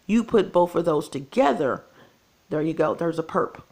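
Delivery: ordinary talk, nothing marked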